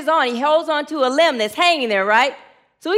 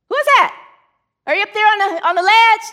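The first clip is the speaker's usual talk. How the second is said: high, raised shout upward; startled calling out; 6 semitones higher, 4 dB louder, faster than usual. Acted. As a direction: call up in alarm to an unseen stranger. Startled man calls up to voice.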